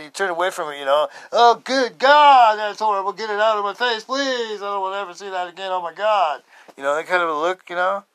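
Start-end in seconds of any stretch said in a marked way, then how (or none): 1.3-6.4 acted revulsion. Onlooker begging not to see it, appalled. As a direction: plead in mock disgust, turning away. Acts onlookers recoiling from hand.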